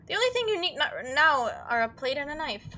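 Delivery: ordinary talk, nothing marked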